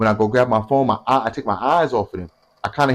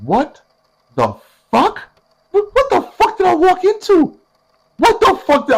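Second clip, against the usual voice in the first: much higher, louder, squeaky shocked outburst; shocked disbelief; 4 dB louder than usual. Retold or imitated. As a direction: react like you just walked into madness. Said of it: "I'm like".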